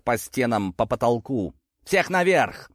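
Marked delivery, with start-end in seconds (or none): 1.9-2.7 крик рассказчика. A narrator's reading. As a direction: call out an urgent order on a higher pitch. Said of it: "заорал я". (приглушённый крик повыше)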